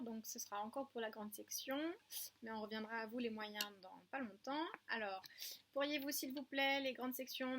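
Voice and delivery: ordinary talk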